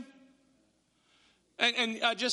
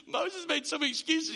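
mock amused chuckle; 6 semitones higher than usual. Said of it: "I mean, we're like".

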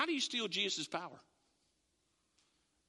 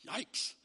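whispered yikes. Acted. Mocking crowd reaction.

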